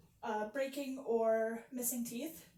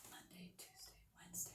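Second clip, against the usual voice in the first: soft breathy whisper test; barely whispered, no voice at all; 7 semitones lower, 18 dB quieter than usual. Shown as a demonstration. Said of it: "I'm just gonna whisper".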